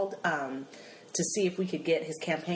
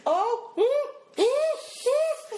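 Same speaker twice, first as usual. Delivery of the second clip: high, spluttering indignation; mock-outraged mother cutting off the doctor; outraged refusal; 11 semitones higher than usual. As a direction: refuse him in a shrieky high pitch, ending in huffs. Acting mother refusing doctor's apology.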